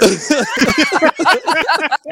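high, loud laugh bursting out mid-story; big burst of laughter. Real, not acted. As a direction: crack up at your own story. Laughing at his own retelling.

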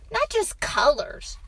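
put-on high, loud little-boy voice; protesting boy. A narrator's reading. Boy demands a real story, protesting. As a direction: insist like a small child.

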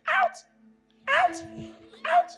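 high, feeble "Out"; weak, squeaky command. Retold or imitated. Preacher mocking a timid voice against the devil. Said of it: "if your voice is".

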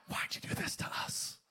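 hushed, breathless whisper; whispered parent's complaint. Retold or imitated. Preacher mimics parents to their kids, whispered. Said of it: "Any parents in here ever said that".

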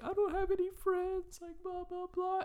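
high, sniveling whimper; high pitiful whimpering voice; mock whiny crying clown. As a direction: whine like a fake-sad clown. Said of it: "he's like".

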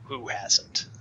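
low, whispery villain retort; hushed, low villain comeback; cool menacing villain. Acted. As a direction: toss it back low, almost whispered. Villain's dry reply to a death confession.

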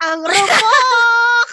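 a high, slow, drawn-out shout of "Ang rupok!"; a crying-laugh shout of self-mockery; rueful shout. Real, not acted. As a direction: wail it out, laughing at your own weakness. Mock self-scolding exclamation at herself.

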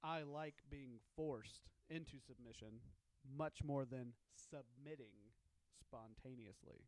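slow and careful, every word clear; careful reread. Shown as a demonstration. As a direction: read it out slowly and clearly. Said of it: "can you say that with real words?".

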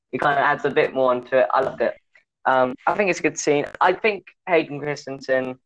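ordinary talk, nothing marked

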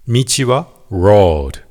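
Road slowly with a rounded English R; careful English Road. Shown as a demonstration. Teacher contrasts Road, meaning road, with Lord.

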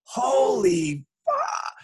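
high, slow, drawn-out gasp of disbelief; stunned awe. Retold or imitated. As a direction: say it like you just saw your hero. Kid recognizes Wayne Gretzky.

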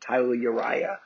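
Boston accent, dropping the R in "Tyler". Shown as a demonstration. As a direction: say "Tyler Uriah" like a Bostonian, "Tyluh". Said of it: "imagine being said in a Boston accent".

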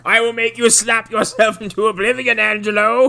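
high, loud put-on villain voice; menacing villain. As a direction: sneer it like a movie telepath threatening. Acting villain line from film.

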